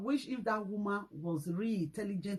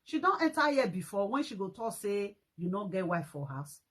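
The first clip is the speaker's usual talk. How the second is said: ordinary talk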